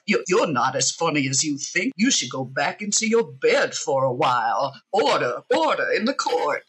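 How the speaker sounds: lightly voiced blustery Southern rooster judge; a hint of a pompous old Southern judge; Foghorn Leghorn. Inner critic mocking her in a light Foghorn Leghorn voice. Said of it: "talks like Foghorn Leghorn".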